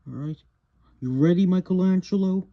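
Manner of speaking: ordinary talk, nothing marked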